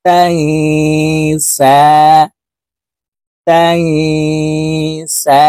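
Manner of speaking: the সা held one alif long; long সা vowel, one count; one alif stretch. Shown as a demonstration. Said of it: "একালিপ টান হবে".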